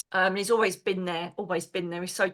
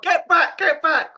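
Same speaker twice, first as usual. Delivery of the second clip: Kevin Costner bodyguard; 7 semitones higher, 5 dB louder, slower than usual. Retold or imitated. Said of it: "like Kevin Costner in Bodyguard".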